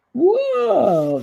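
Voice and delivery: high, drawn-out whoa of surprise; surprised whoa. Real, not acted. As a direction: react with delighted surprise. Surprised by menu items.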